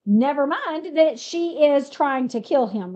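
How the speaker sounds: usual speaking voice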